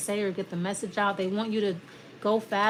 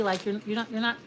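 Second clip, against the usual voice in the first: ordinary talk, nothing marked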